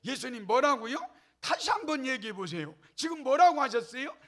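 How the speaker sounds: acted loud, affronted demand; hammed-up furious challenge; indignant outburst. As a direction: play someone blowing their lid, voice climbing at the end. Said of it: "막 뚜껑이 열려가지고".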